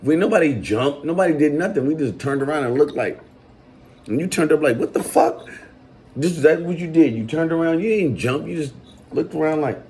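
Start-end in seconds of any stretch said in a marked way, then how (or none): 4.8-5.5 mock startled. Retelling his reaction.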